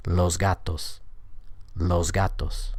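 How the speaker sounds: "Los gatos" with the S voiced as Z before the G; assimilated pronunciation. Shown as a demonstration. Teacher showing learners the assimilated pronunciation, S voiced to Z in "Los gatos". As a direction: say "Los gatos" with a buzzing Z ending "Los". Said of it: "Here's what the assimilated pronunciation sounds like".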